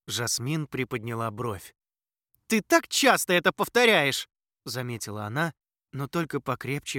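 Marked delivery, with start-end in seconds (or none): 2.5-4.3 loud, high teasing retort; shouting. Acted. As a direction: shout the jab over wind. Retort while flying in wind.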